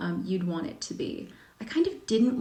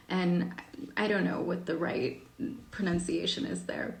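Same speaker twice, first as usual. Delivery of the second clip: normal talking voice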